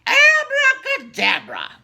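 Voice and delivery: high, loud sing-song witch cry; witch casting a spell. Acted. Witch waving her wand at her cat, loud high spell. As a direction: fling the magic word out like a cackling witch. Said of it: "and said".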